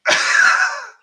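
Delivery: a sudden high burst of laughter; loud high laugh. Real, not acted. Host cracks up at the court story, loud high laugh.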